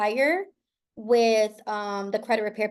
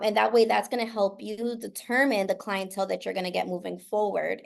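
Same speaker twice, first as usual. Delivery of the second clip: usual speaking voice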